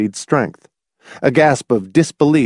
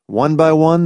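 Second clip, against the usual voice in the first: usual speaking voice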